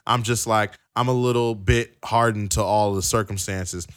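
ordinary talk

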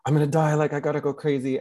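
high, fast hammed-up panic; mock panic. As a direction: play Haman losing it, voice up high. Haman panicking before his death.

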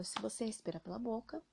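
normal talking voice

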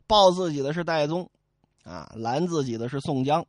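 plain reading voice

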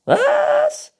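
very high, loud squeak; shouting squeaky raccoon; hard-of-hearing raccoon. A narrator's reading. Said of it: "Du musst lauter reden". (schwerhöriger Waschbär, hoch und laut)

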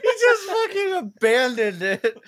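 high, loud, slow laughing. Real, not acted. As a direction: cackle out the words. Laughing at friend.

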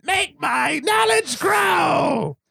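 high, slow, hammed-up villain call; mock villain cry. Joking host hams up a Rita-style villain cry. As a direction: stretch it out like a cartoon sorceress.

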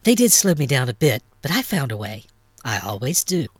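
a touch of sly confidence; understated, knowing reply to an old friend. A narrator's reading. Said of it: "replied Nix slyly".

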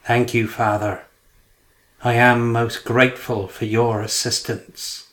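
usual speaking voice

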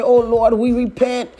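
ordinary talk, nothing marked

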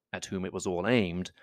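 plain reading voice

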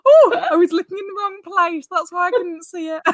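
laughing. Real, not acted. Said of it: "You're very excited".

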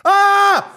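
high loud mock scream; imitated old man's yell. Host imitating the neighbour's startled yell, high and loud. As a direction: yelp like he's falling off a cliff. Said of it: "And he's yelling".

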